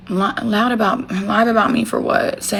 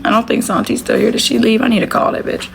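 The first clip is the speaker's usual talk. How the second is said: usual speaking voice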